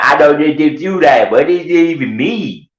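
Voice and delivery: high-pitched, agitated mock voice; hammed-up high-voiced protest; Glenn. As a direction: imitate a worked-up, baffled man. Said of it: "I'd ask Glenn, and he'd be like".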